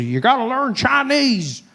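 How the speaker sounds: high hammed-up alarmist rant; shrill-free high panicky mock warning. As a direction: squeal it like a panicked pundit. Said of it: "I see people say".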